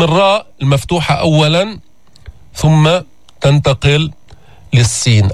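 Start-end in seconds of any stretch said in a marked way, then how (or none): none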